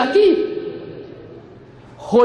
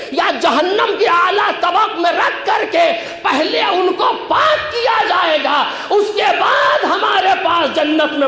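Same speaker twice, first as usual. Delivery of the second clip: impassioned preaching; 4 semitones higher than usual. Acted. Preacher telling the congregation who will enter paradise, impassioned. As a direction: preach it forcefully to a packed gathering.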